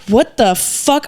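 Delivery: ordinary talk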